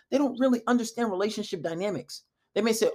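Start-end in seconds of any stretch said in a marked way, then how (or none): none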